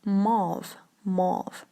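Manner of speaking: mauve as one syllable, MAWV; correct pronunciation. Shown as a demonstration. Teacher giving learners the right mauve, careful mawv. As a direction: mauve with a rounded AW vowel. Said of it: "this should be pronounced like".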